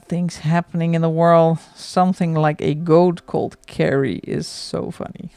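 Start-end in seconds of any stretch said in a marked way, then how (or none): none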